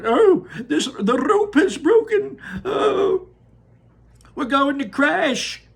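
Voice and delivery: high, loud panicky wail; panicked cry. Acted. Mrs TV sure they will crash, crying out in panic. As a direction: wail that the rope has snapped. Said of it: "Missus T V cried out".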